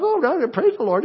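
put-on high, lightly cooing baby voice; baby talk. Grandfather imitating the baby's praise, high baby talk. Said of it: "just baby talk".

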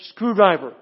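loud doctor's command; acted demand to the staff; barked order. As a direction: call out for the tool. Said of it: "barking out these unsettling string of orders".

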